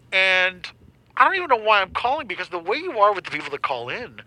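indignant caller in a put-on phone voice. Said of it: "The phone voice is fun".

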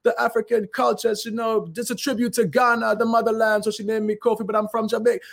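high-pitched, put-on bad Jamaican accent; retelling a fake Jamaican accent to a reporter, hammed up. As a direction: stay in character with a clumsy Jamaican accent. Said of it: "You know, terrible Jamaica".

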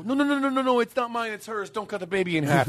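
high, frantic no, no, no; panicked pleading. Retold or imitated. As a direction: beg them not to cut the baby. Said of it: "and they go".

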